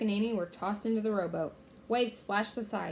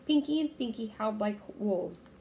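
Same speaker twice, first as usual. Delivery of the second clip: usual speaking voice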